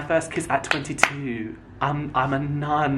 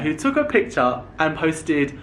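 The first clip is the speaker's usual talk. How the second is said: normal talking voice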